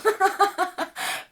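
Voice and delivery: high burst of real laughter; bright laugh. Real, not acted. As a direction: laugh out at yourself. Laughs about singing in an accent.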